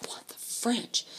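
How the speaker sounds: whispered disbelief. Retold or imitated. Narrator seeing clean teens, slow whispered disbelief.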